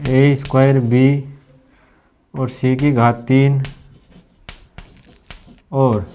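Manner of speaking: धीरे-धीरे, शब्द अलग-अलग करके; छात्रों को लिखवाते हुए रुक-रुक कर; slow dictation. Shown as a demonstration. Dictating a question to note. (प्रश्न लिखवाते हुए धीमी, ठहरी आवाज़)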